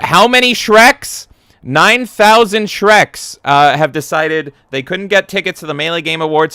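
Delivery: hammed-up excited field report on the Shrek war; hyped breaking news. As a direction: announce the battle like an urgent newscaster.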